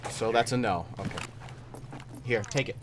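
normal talking voice

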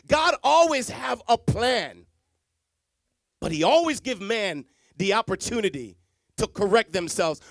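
ordinary talk, nothing marked

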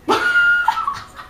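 squeaky high laugh; high-pitched laugh. Real, not acted.

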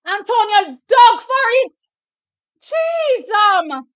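high, loud race-fan excitement; real high-pitched elation; thrilled exclamation. Real, not acted. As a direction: exclaim it, overcome by the finish. Celebrating athlete's final stretch.

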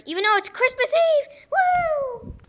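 high, loud burst ending in a whoop; overexcited. Pretend. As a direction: burst out with holiday glee. Excited outburst about Christmas Eve.

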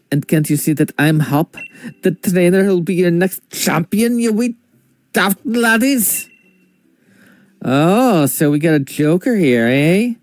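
cheeky game rival in a put-on accent; cocky rival trainer. Trainer announcing the next champion, cocky put-on voice.

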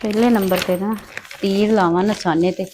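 low, soft plotting to herself; scheming aside. Acted. Daughter-in-law alone, plotting against the family, in a quiet scheming murmur. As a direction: murmur the plan quietly, for no one else to hear.

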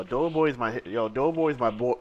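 ordinary talk

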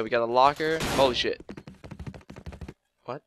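usual speaking voice

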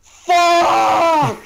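high shout cracking into laughter; laughing shout. Real, not acted. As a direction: yell it, half laughing. Outburst at teammate's joke.

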